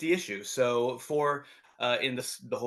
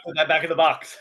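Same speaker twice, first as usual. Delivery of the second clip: lightly voiced parent, louder and higher; mock parents; 4 semitones higher, 9 dB louder than usual. Parents reacting to a smelly toy, mock loud order. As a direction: order it loudly, like an exasperated parent. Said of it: "My parents were like".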